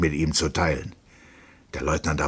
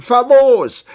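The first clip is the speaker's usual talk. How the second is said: very loud, high exclamation; delighted shout; 13 dB louder than usual. A narrator's reading. Captain cheers the suggested buyer, shouting with delight. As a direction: shout it out, overjoyed. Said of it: "wiederholte der Hauptmann".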